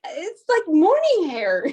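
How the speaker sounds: high, loud incredulous question; mock disbelief, slowing on morning hair. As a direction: protest that she couldn't recognize you. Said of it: "I was like".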